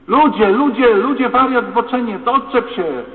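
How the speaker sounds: high, loud panicked screams at an attacker; a woman screaming at a stranger who grabs her; screaming for help. Acted. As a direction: yell for help at the top of your voice.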